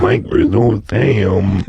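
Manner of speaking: slow, low computer voice on an in-breath; Microsoft Sam. Shown as a demonstration. Co-host trying the breathe-in trick, low inhaled robot voice. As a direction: drag it out while breathing in. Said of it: "Do it on the in-breath".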